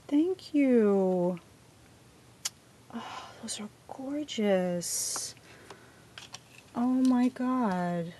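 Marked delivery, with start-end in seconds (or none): 2.9-5.3 slow breathy whisper of awe; awed whisper. Real, not acted. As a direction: whisper it slowly, amazed.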